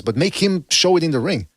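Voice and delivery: normal talking voice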